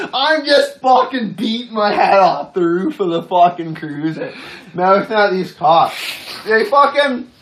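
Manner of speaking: ordinary delivery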